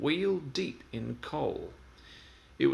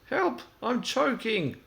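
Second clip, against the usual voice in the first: much higher panicked cry for help; 11 semitones higher, 4 dB louder, slower than usual. Acted. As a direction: yelp it out as coal pours down. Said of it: "cried Thomas".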